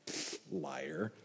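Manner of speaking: a dismissive breathy aside; scoffing whisper. Real, not acted.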